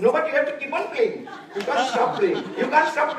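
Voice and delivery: laughing. Real, not acted. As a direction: cheer them on while laughing. Teacher laughing, urging players on.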